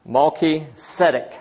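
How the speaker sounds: "Malki Zedek" said as two distinct words; "Malki Zedek" carefully split in two; careful Hebrew name pronunciation. Shown as a demonstration. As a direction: pronounce "Malki", then "Zedek", deliberately. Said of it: "we would pronounce it as".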